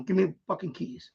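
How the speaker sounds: usual speaking voice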